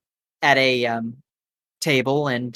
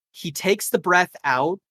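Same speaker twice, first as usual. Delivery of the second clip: ordinary talk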